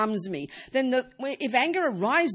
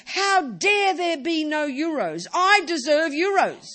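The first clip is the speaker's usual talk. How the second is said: higher, louder, slow indignant protest; mock indignant outrage; 7 semitones higher, 6 dB louder, slower than usual. Teacher mimics someone outraged at an empty bank account, loud and slow. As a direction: huff it out like a wronged customer. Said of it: "you don't get angry and say".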